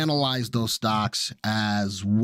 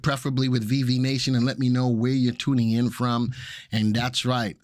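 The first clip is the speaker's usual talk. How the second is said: normal talking voice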